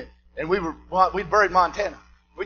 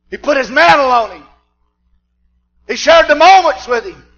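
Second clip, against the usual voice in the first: loud, high shouted preaching; fiery preaching; 11 semitones higher, 13 dB louder than usual. Acted. Preacher to his church, full-force shouted sermon.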